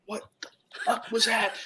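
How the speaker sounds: high, hushed startled question; a shaken what was that. Retold or imitated. Marine startled by a jungle scream, hushed and high.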